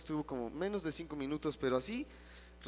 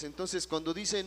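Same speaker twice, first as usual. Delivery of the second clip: ordinary talk, nothing marked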